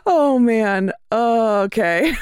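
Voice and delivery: breathless after a laughing fit; after laughing hard. Real, not acted. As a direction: still catching breath from laughter. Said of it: "My face hurts from laughing already".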